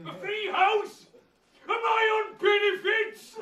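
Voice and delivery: high, loud, slow outraged yell; shocked shout. Acted. Badger yelling to Mole in shocked realisation. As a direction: yell it as the penny drops.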